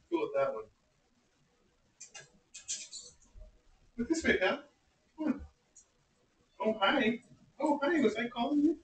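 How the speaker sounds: high, sweet pet talk; adoring. Real, not acted. Talking sweetly to the cat.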